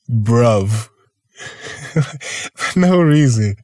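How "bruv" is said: British slang. Retold or imitated. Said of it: "You know what I've been saying lately".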